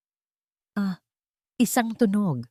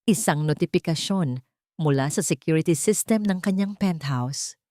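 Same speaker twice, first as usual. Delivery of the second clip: ordinary delivery, nothing marked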